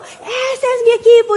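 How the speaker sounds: high-pitched proud cheer for the team; fan's shout. Acted. Fan in the stadium cheering the home team, shouting. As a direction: cry out like a fan caught up in the crowd. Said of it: "otros están gritando".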